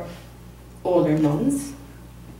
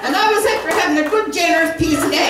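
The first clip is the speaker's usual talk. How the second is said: laughing; 8 semitones higher, 3 dB louder than usual. Real, not acted. Laughing over dog food story.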